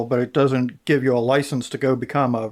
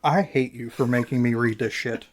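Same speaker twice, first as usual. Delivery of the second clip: normal talking voice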